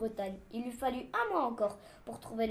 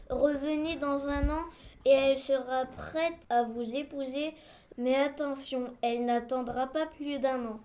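very slow, measured character voice; solemn explanation, words stretched; ponderous official. Acted. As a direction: spell it out slowly and weightily. Reads the objector's part.

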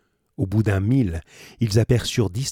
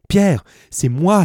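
loud, higher, slow pleading call; crying out; 9 semitones higher, 8 dB louder, slower than usual. A narrator's reading. Penellan trying to rouse stunned Pierre, loud slow cry. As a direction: reach a dazed friend, loud and slow. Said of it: "s'écria Penellan".

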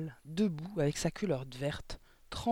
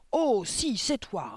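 high, loud start, falling off; angry, emphatic contradiction; roared retort; 7 semitones higher, 4 dB louder, much slower than usual. A narrator's reading. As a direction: roar back an accusation. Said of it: "rugit mademoiselle Le Gourdin".

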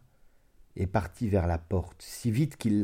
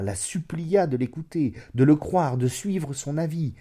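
raised, pressing narration; pleading insistence; 6 semitones higher, 4 dB louder than usual. A narrator's reading. Narrator telling how he begged her, imploringly. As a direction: build the list like an entreaty. Narrator enacts the begging described.

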